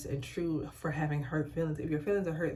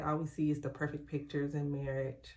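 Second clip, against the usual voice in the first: ordinary talk, nothing marked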